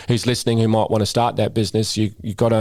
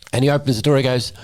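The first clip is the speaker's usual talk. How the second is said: ordinary talk, nothing marked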